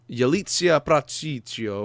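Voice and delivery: slow, exaggerated Italian lilt on the name; Italian accent. Podcaster attempting an unfamiliar actress's name, in an Italian accent. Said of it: "said it in an Italian accent".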